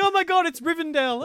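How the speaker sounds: awestruck cry. Retold or imitated. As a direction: gasp at the fantasy valley. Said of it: "And then you take the corner and like".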